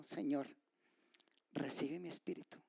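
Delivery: soft, low-key dying prayer; hushed prayer. Acted. Preacher voices Stephen's last prayer, hushed. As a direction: hush it, pausing after the first word. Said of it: "y que le dijo".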